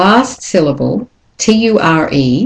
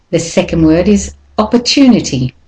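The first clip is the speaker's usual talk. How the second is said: usual speaking voice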